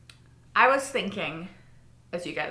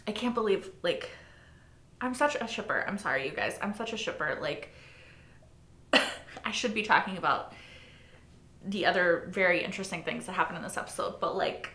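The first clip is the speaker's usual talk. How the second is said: normal talking voice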